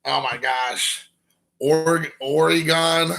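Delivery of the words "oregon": Oregon as "OR-ee-gone"; mispronunciation. Shown as a demonstration. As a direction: open up the end of Oregon into gone. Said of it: "Oregon has been Oregon".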